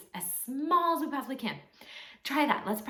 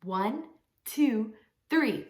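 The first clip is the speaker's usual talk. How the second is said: usual speaking voice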